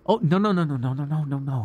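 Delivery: quick high flustered nos; flustered protest. Acted. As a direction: hurry to calm him, a bit panicked. Flustered reassurance to master.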